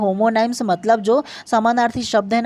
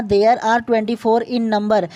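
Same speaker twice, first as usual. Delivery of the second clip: slow dictation; much slower than usual. Shown as a demonstration. Reads the count from the slide.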